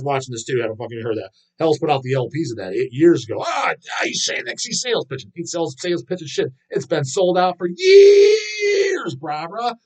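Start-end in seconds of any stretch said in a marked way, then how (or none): 3.5-9.8 high, shrill-pitched mocking yell; mock heckler. Mocking a viewer who calls it a sales pitch, high mock yell. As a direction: ham up a whiny know-it-all critic.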